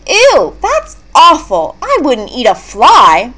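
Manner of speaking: high, loud, squeamish plant voice; disgusted cry. Acted. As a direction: recoil from the very idea. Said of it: "Venus cried".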